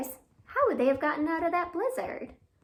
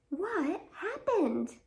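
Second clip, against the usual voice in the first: slow, higher, drawn-out question; curious question; 6 semitones higher, much slower than usual. Acted. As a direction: ask the worm with wide-eyed curiosity. Asking the worm in the rhyme.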